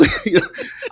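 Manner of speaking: squealing high laugh. Real, not acted. Storyteller cracking up about the stolen jacket, a high laugh. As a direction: let it burst out high.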